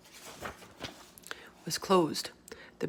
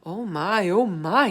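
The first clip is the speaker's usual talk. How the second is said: awed; 2 semitones higher, 6 dB louder, slower than usual. Acted. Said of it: "he said, holding the jar".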